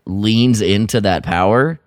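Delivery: ordinary talk, nothing marked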